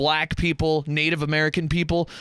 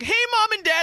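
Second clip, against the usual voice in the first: mock cheerful greeting; 13 semitones higher than usual. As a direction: call out brightly on coming home. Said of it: "He's like".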